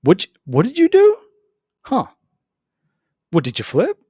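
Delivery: high, loud booming question from God; mock-astonished God demanding answers; God's voice. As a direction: play God, incredulous. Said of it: "And then God was like".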